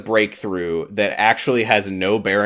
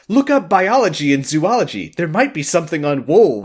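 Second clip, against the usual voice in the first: higher, lightly hammed-up urgency; urgent acted call; 7 semitones higher, 3 dB louder than usual. Rose sending them to search books, urgent acted call. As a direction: call instructions across a busy room. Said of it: "everyone's yelling over each other".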